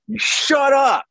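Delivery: sudden high, loud "shut up" at the inner voice; shouted rebuke. Shown as a demonstration. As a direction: snap it out like telling off a troublemaker. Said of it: "there's a little prayer".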